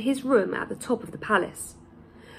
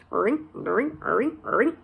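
ringing metal sound; 4 dB quieter, much slower than usual. A narrator's reading. Narrator voices wire twisting.

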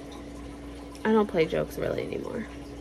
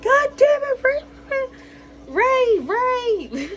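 mock outraged mom; 4 dB louder than usual. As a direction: drag out each Ray in shock. Mom scolding after scare.

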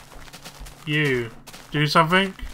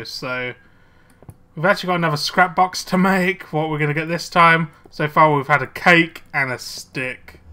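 ordinary delivery

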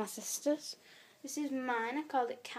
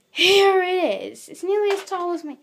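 grand reveal; 6 semitones higher, 14 dB louder than usual. Real, not acted. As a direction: drag it out like a big unveiling. Reveals the biggest teddy.

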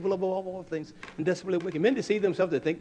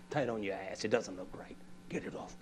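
lower, softer blunt order; low flat husband; 5 semitones lower, 9 dB quieter than usual. Retold or imitated. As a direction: keep it low and curt. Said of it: "I talk like that".

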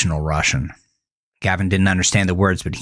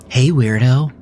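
teasing woman; 6 semitones higher, 4 dB louder than usual. A narrator's reading. Woman teases Gavin.

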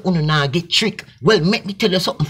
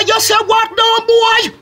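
much higher, louder yell of disbelief; incredulous shout; 3 dB louder than usual. Acted. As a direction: scream it back in outrage. Acted quarrel, shouting back.

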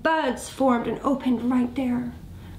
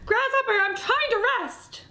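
high, drawn-out weepy wail; tearful; 12 semitones higher, slower than usual. Acted. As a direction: plead in a high, crying voice. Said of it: "She was in tears".

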